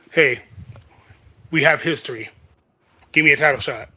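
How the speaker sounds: loud, punchy wrestler demand; mock demand. Wrestler demanding a title shot, loud and blunt. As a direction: fire off each short phrase loudly.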